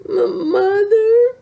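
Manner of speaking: high, slow, stammering little cry; a trapped cub calling out; small voice calling. A narrator's reading. Trapped cub calling to her mother, high and loud. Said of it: "they heard a small voice".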